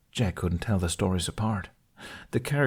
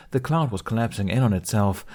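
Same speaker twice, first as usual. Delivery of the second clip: ordinary delivery, nothing marked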